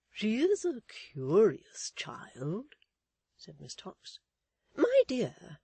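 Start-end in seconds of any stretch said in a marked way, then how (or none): 0.1-2.8 slow, high, prim lady; a genteel old maid, slowly; prim spinster voice. A narrator's reading. Miss Tox speaking. 4.8-5.6 high, loud, fussy lady; an indignant society matron. A narrator's reading. Mrs Chick speaking.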